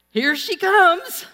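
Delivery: high, loud and slow as she charges; excited alarm. Retold or imitated. Player charging in.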